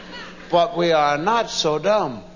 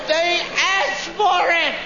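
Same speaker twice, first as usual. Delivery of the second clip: high indignant retort; high-pitched comic indignation; indignant self-defence; 10 semitones higher than usual. Retold or imitated. Retold defensive quote to wife.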